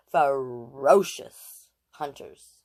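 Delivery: Ferocious drawn out slowly and relished; savoring ferocious. Shown as a demonstration. She loves the word, so she says Ferocious slowly and savors it. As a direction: linger on Ferocious, very slow. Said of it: "I love that word".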